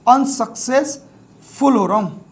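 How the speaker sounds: Unsuccessful slowly, in drawn-out chunks; sounded out slowly. Shown as a demonstration. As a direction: dictate unsuccessful piece by piece, as un, success, ful. Teacher sounds word out while writing.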